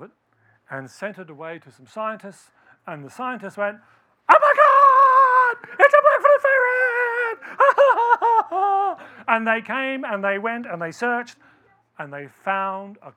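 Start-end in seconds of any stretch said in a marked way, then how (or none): 4.3-7.4 very high, loud and slow, screaming with joy; ecstatic shout. Retold or imitated. Scientists shout on finding the ferret, high, loud, overjoyed. 7.4-9.2 loud, high delighted laugh; high gleeful laugh. Retold or imitated. Laughing after acting out the scientists' joy, high and loud.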